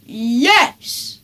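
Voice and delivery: a father yelling back; Dad yelling back at Henry; screamed. A narrator's reading. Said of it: "screamed Dad".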